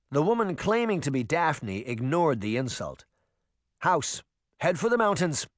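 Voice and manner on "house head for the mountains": shouting. A narrator's reading. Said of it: "she shouted".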